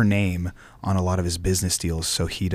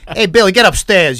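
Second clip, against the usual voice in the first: much higher, much louder hotheaded yell; 12 semitones higher, 10 dB louder than usual. Retold or imitated. Actor imitating his hothead character yelling at Billy, high and loud. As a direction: explode up top like a gangster blowing his stack. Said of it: "'cause he lives up here".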